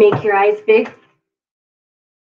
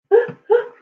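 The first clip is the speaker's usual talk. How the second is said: gasp; 11 semitones higher than usual. Acted. Storyteller reacts to bear sneeze.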